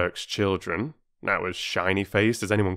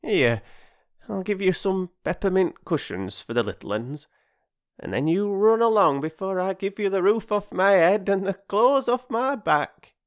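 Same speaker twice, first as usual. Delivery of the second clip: put-on high, hearty old woman, slowing at the end; old woman sending children off with sweets; generous old woman; 12 semitones higher, 4 dB louder than usual. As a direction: shoo the children off kindly.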